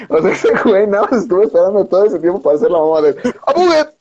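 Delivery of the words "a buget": sudden high yelled gotcha, much higher and louder; spring it on him like a prankster's battle cry; prank yell. Retold or imitated. Retelling the prank yell again.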